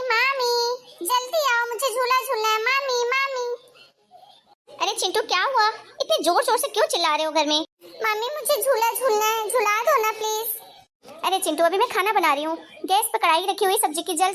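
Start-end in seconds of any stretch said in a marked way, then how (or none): 0.1-3.5 put-on higher childish calling; a little boy calling his aunt through the house. Child calling aunty across house. 8.0-10.6 put-on higher, slow childish begging; a boy begging his aunt for the swing; little boy pleading.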